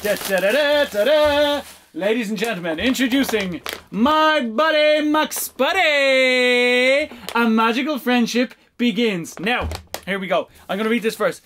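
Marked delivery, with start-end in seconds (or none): 0.0-7.1 slow, drawn-out announcer reveal; mock fanfare. Introducing the toy to viewers like a showman. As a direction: present it like a game show prize.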